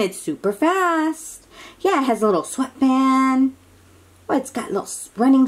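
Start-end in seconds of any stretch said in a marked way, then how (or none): none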